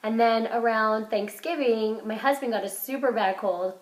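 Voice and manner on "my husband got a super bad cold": normal talking voice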